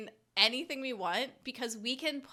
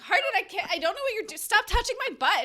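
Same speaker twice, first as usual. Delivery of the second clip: mock flustered protest; 10 semitones higher, 6 dB louder, faster than usual. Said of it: "And she's like".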